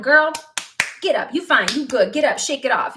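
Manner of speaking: loud, brisk no-nonsense urging; sassy drill-yourself pep talk; tough self pep talk. Retold or imitated. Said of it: "that's my personality".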